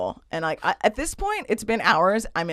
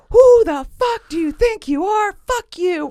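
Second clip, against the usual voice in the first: high, loud, drawn-out enraged scream; 13 semitones higher, 7 dB louder, slower than usual. Retold or imitated. Drunk inmate screams at ignoring guard, outraged yelling. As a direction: scream it slowly, far above your usual pitch. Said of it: "I start screaming and I'm like".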